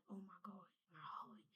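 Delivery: breathless hushed whisper, voice almost gone. Retold or imitated. Telling viewers how she felt her grandmother, in a hushed whisper. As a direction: whisper it like your heart just skipped.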